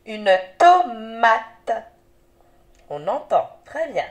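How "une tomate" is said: slow clear demo word. Shown as a demonstration. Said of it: "Dis si tu entends M".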